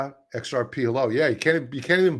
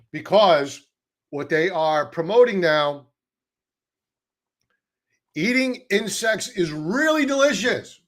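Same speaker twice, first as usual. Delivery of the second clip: higher, louder, a salesman's fake cheer; mock enthusiasm; 5 semitones higher, 4 dB louder than usual. Vlogger mocking the insect-protein push, sarcastic cheer. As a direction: sell it like a cheesy ad you don't believe.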